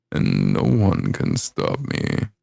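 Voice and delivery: vocal fry. Pretend. Villain line in fry.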